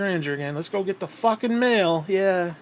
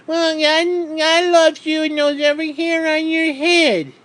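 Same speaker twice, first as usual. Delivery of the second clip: much higher, slow sugary preacher voice; mock pious believer; 10 semitones higher, 4 dB louder, slower than usual. Ranter mocking religious comfort, high slow pious voice. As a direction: parody a syrupy churchgoer's comfort. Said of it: "It's like".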